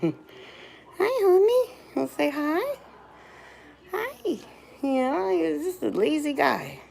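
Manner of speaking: high soft cooing pet voice. Real, not acted. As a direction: coo at the pet softly.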